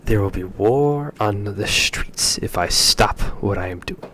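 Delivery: furious loud official; loud angry official. Acted. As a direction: hammer the warning home loudly. Official shouting at diplomats.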